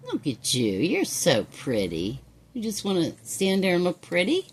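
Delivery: ordinary talk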